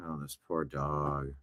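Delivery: mild mock pity, very soft. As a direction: murmur it in sorrow. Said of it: "pity phrase again, soft".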